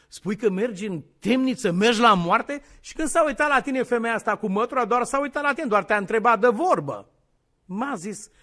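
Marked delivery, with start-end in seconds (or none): 0.1-7.1 loud, raised, incredulous scolding; mock scolding. Preacher chiding Peter over the woman with the broom, mock scolding. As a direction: scold with rising force, acted.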